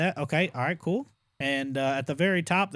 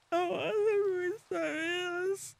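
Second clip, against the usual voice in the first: mock crying whine; 5 dB quieter, slower than usual. Dreading a restart after dying.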